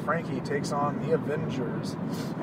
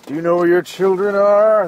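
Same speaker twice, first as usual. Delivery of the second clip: mock PSA announcer; 9 dB louder than usual. Podcast host opens with a mock TV-announcer warning. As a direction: play a TV announcer warning parents.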